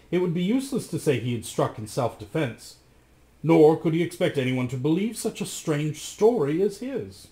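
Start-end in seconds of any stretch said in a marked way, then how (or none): none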